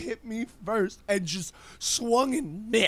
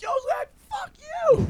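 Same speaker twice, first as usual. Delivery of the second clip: very high, strained retort; mock angry yell. Cussing back at drunk friend in the bedroom, mock angry yell.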